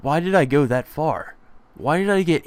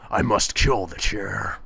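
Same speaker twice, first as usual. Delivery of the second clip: slow menacing vow; 3 semitones lower, slower than usual. Acted. Deadpan vow against chair.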